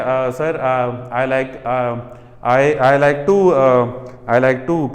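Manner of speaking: halting low answer full of uhs and restarts; hesitant student. Shown as a demonstration. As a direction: stumble through like a nervous candidate. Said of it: "ये चीज़ हो जाती है".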